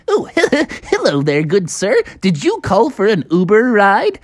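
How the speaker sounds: hammed-up polite driver voice; pig driver.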